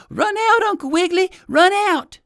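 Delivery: put-on very high, loud squirrel-boy shout; urgent cry. A narrator's reading. Johnny calls to trapped Uncle Wiggily, urgent high shout. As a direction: yell a warning from the treetop. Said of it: "cried Johnny".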